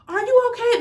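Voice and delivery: high, concerned "are you okay"; concerned stranger. Retold or imitated. Random woman checks on girl.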